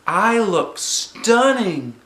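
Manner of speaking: high, loud, very slow self-admiration; delighted vanity. Acted. Junie B admires her flower hair, high and slow. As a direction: savour every word like a star.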